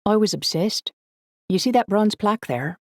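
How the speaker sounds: high, enthusiastic feminine voice; light girlish voice, full of interest; eager girl. A narrator's reading. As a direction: pitch it up like a keen local history buff. Narrator voices the girl Emily.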